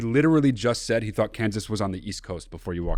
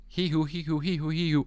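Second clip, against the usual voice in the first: siren noise; 6 semitones higher, 5 dB quieter than usual. Pretend. Imitating a siren.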